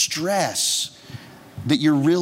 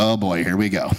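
weary dread; 7 semitones lower than usual. Retold or imitated. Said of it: "I was like".

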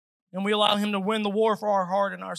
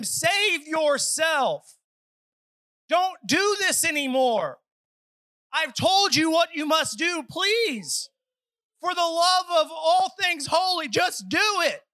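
pleading shout; 4 semitones higher, slower than usual. Acted. Peter pleading with the crowd to save themselves, shouting. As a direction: beg them at full force, like a desperate preacher.

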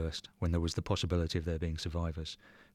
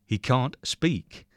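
slow, loud, higher, each word hammered out; He can't speak, every word slow and forced louder; losing his temper; 7 semitones higher, 5 dB louder, much slower than usual. A narrator's reading. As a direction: lose your temper and spell out He can't speak. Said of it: "Takashi loses his temper".

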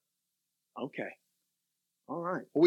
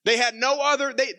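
intense preaching; 6 semitones higher, 3 dB louder than usual. Real, not acted. Preacher building on the lost.

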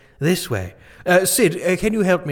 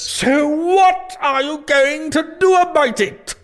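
demanding king; 13 semitones higher, 3 dB louder, slower than usual. Acted.

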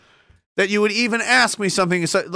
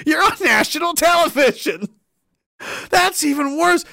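high-pitched amused disbelief; incredulous; 9 semitones higher than usual. Real, not acted. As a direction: exclaim high, as if it can't be true. Reacting in disbelief to TV remark.

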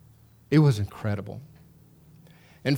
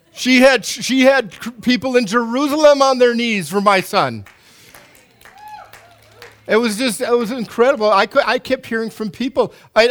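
overwhelmed; 10 semitones higher, 6 dB louder than usual. Real, not acted. Overwhelmed recalling prayer for son.

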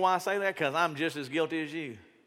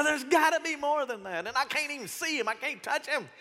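higher-pitched, mildly pleading doubt; struggling doubter; 8 semitones higher than usual. Acted. Struggling believer protesting they can't see him, mildly exasperated.

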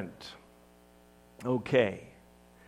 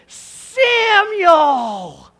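much higher, loud hearty call; booming overfriendly hello; cheerful greeting; 10 semitones higher, 10 dB louder, much slower than usual. Acted. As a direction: hail an old buddy across a field. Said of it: "cheerfully says".

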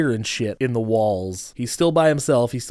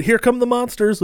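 excited; 12 semitones higher, 5 dB louder than usual. Retold or imitated. Reading own excited note aloud.

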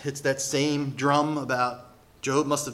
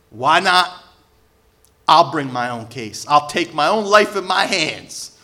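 raised, forceful voice; defiant resolve; 8 dB louder than usual. Acted. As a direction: declare it like taking on your own case. Acting out Job's defiant resolve.

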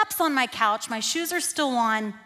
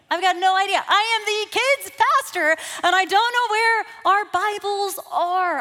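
louder, higher exasperated exclaiming; frustrated outburst; 7 semitones higher, 4 dB louder than usual. Retold or imitated. Kids pastor venting to the congregation, louder and exasperated. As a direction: throw up your hands, exasperated.

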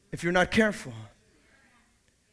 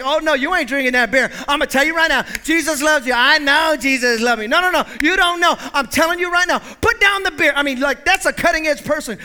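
loud, high preacher's call; preaching; 5 semitones higher, 5 dB louder than usual. Acted. Pastor to the church, preaching at full force. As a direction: preach it out to the congregation.

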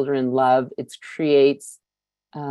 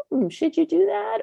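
much higher, doubting nasal-free put-on voice; mother-in-law voice; 13 semitones higher than usual. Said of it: "sounds a lot like my mother-in-law".

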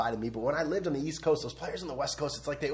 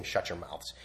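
usual speaking voice

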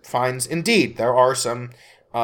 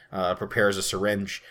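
ordinary delivery

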